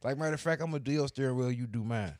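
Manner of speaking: high, loud, playful stunt planning; excited mock instructions. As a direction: call out the daft plan like a countdown is coming. Acts out the car-splitting stunt.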